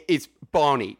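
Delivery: jokey Cockney imitation, breaking into laughter; mock Cockney accent. Co-host mocking Don Cheadle's accent, bad Cockney. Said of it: "his Cockney accent".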